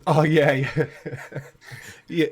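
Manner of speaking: ordinary talk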